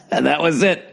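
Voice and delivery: laughing through the line. Real, not acted. Telling how he left his childhood friend, laughing through it. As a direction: let a rueful laugh carry the words.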